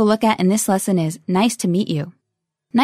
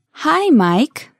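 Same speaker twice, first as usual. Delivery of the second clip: slowly; 4 semitones higher than usual. Shown as a demonstration. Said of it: "one more time slowly".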